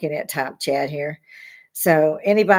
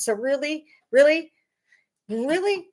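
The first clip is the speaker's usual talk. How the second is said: each really pitched higher, building; rising emphatic really; 10 semitones higher than usual. Real, not acted. Vlogger ramping up her outrage at protesters, rising emphasis. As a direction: climb higher on every really.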